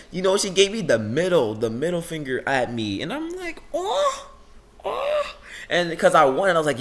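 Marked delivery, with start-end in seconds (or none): 3.7-5.4 very high-pitched scandalized oh; exaggerated falsetto oh; mock shock. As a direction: gasp it out in squeaky mock offence. Retold shock at middle finger.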